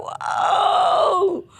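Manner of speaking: high, drawn-out crowd "whoa"; long high reaction cry; mock shocked whoa. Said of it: "You go".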